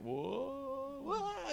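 high startled whoa, whoa. Retold or imitated. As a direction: yelp as you stumble forward. Stumbling when the wind stops.

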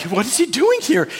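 high startled question, sister retelling; mock astonished whisper-free exclaim; surprised gasp. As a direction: pitch jumps up in disbelief. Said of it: "they're all like".

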